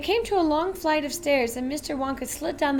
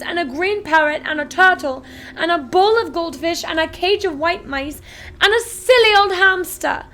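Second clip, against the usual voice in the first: put-on spoilt demanding girl; 4 semitones higher, 10 dB louder than usual. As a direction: insist like a spoilt brat. Veruca tantrum list.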